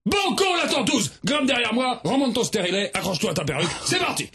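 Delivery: high, shouted rallying cry; gung-ho reply. Acted. Narrator shouts to Zouzou as they set off, excited. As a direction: fire him up for the ride. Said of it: "j'ai répondu à Zouzou".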